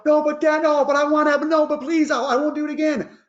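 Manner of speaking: put-on high pleading little-boy whine; whiny child. Dad imitating his son pleading against the closed door. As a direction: beg like a kid stuck in timeout. Son begging to leave timeout.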